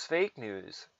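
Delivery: ordinary talk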